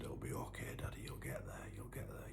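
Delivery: soft unvoiced whisper of a little girl; whispered comfort. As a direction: breathe the words gently, like a child soothing. Little daughters comforting their father.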